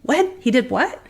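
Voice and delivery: high, startled double-take; retold bafflement. Practice manager hears of the hiring, baffled. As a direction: imitate a manager caught off guard.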